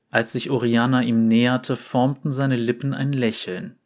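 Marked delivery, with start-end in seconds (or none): none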